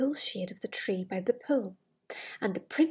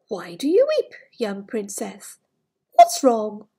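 frog's voice; 13 dB louder than usual. Acted. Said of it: "she heard a voice saying".

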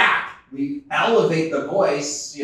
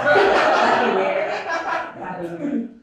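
breathy laughing up high; amused laughter; long high laugh; 14 semitones higher, 5 dB louder than usual. Real, not acted. Laughs at joke about Beth.

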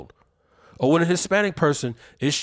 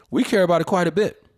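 ordinary talk, nothing marked

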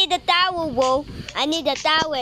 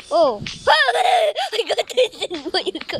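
laughing through the words; 4 semitones higher than usual. Real, not acted. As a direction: crack up while saying it. Laughs at game glitch.

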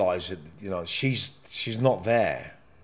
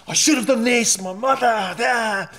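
higher, louder cry of regret; pitched-up anguished wail; rising anguish; 10 semitones higher, 4 dB louder than usual. Pretend. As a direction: let the regret burst out high. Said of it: "And the anger comes out".

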